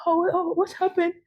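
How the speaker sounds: high, concerned motherly voice; landlady voice. Retold or imitated. Landlady asking the upset tenant what happened. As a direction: ask with kind worry. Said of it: "she gives me the cup of tea".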